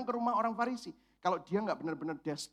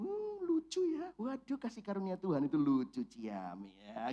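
mock cutesy; 5 dB quieter than usual. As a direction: coo over something sweet and tiny. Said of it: "cute gitu".

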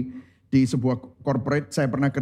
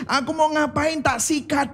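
higher-pitched eager boast; begeistert nachgeahmt; 10 semitones higher than usual. Retold or imitated. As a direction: imitate a thrill-seeker declaring he'll try everything. Voices boss's live-once philosophy.

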